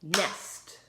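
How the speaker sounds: "Nest" said as one loud clapped beat; "Nest" chanted with a clap; clapped syllable. Shown as a demonstration. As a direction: beat out "Nest" once, firmly. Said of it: "clap it".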